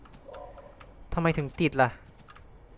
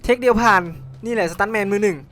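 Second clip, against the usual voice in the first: higher and louder, proud of the stunt; excited cheer; 6 semitones higher, 5 dB louder than usual. Real, not acted. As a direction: shout the win like a proud stuntman. Cheering after landing a stunt. (ตะโกนดีใจอย่างภูมิใจ)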